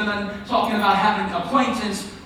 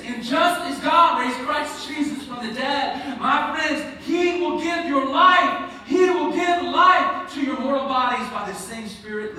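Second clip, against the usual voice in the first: high, emphatic pulpit delivery; ringing preacher's declaration; forceful preaching; 8 semitones higher than usual. Real, not acted. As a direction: proclaim it to the congregation.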